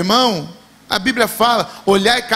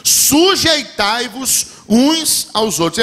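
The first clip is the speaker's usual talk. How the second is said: drawn-out words with a raised push; slow emphatic command; much slower than usual. Acted. Preacher voicing Jesus' command to the church, slow and weighty. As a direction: lean on each word as a command. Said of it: "Jesus falou assim".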